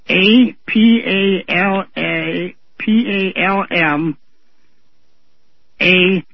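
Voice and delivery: A P A L A, P A L M, A, T O X, each letter slow and separate; spelled out slowly. Shown as a demonstration. Caller to host, slowly spelling out the mispronunciation. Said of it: "I'll spell it the way it was pronounced".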